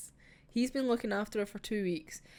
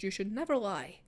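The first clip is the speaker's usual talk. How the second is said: ordinary talk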